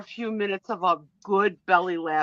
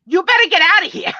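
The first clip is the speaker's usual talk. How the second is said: high, loud mock-tough order; imitated rough yell, pitched way up; rougher; 11 semitones higher, 11 dB louder than usual. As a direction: throw it out rougher, imitating a forceful demand. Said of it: "say it a little rougher".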